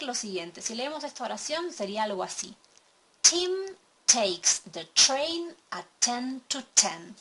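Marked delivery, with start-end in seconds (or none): 3.2-7.1 "Tim takes the train at ten to ten" with each T hard and aspirated, slow and loud; forceful aspirated English t's; strong English T. Shown as a demonstration. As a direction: spit out every T with a burst of air, slowly. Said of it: "esa T tiene mucha fuerza".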